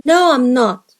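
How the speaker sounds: normal talking voice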